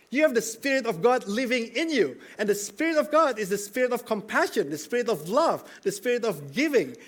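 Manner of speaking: rising sermon climax, high and loud; preaching at full force. Acted. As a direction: lift the congregation with passion.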